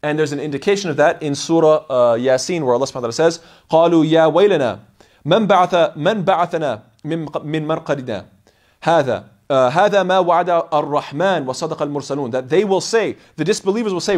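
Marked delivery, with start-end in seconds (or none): 3.7-12.3 fast, chanted Arabic recitation; Quran recitation. Acted. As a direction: recite the verse in flowing tajweed.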